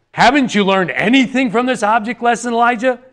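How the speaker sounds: high-pitched, exasperated scolding; acted indignant telling-off; stern reprimand. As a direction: scold him like a pupil who missed the lesson. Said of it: "rather than sternly reprimanding Elijah by saying".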